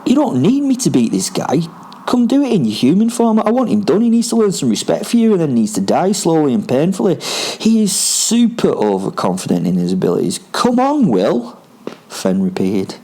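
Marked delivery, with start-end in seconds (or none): 0.0-11.6 high, swooping, urgent character voice; indignant spirit companion Fen. Acted. Fen urging Will to fight in human form, indignant swooping voice. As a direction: egg him on with wide pitch swoops.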